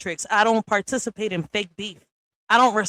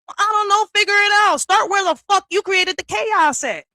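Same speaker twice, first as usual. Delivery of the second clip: exasperated outburst; 11 semitones higher, 4 dB louder than usual. Real, not acted. Angry rant at people blaming her.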